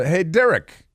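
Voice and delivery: lightly hammed-up Italian Biden accent; mock Italian accent. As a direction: joke Italian accent for a tweet. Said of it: "it goes like that".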